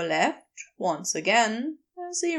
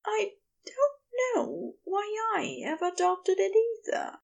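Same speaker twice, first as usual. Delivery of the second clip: high, bright character voice; lifted, happy high voice; bright, smiling; 9 semitones higher than usual. A narrator's reading. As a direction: answer with a smile, pitched well up. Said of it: "Aziraphale smiled brightly".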